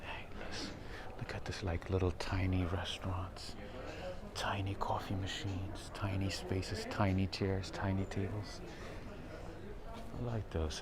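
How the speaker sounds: soft hushed voice; whispering. Real, not acted. Whispering inside small restaurant area.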